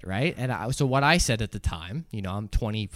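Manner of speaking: normal talking voice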